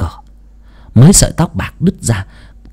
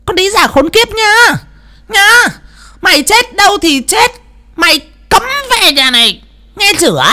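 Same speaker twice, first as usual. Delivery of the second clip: shrill, much higher screeching harridan voice; roaring shrew. A narrator's reading. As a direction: spit it out as a vicious mistress. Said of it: "chỉ tay mà gầm lên". (giọng mụ đàn bà chua ngoa gầm lên)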